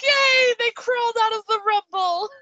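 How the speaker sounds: high gleeful yay; mock cheer. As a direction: cheer like they were saved.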